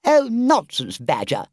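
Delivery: high, loud, dismissive scoff; airy Toad. A narrator's reading. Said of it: "said Toad rather airily".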